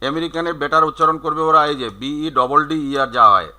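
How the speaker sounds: ordinary delivery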